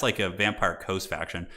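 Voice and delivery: usual speaking voice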